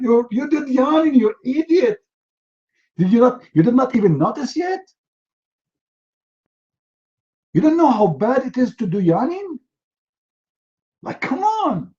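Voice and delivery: high-pitched mock-angry scolding; exasperated judge. Judge scolding the clueless yawner, mock exasperation. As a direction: berate them in hammed-up disbelief, voice rising high.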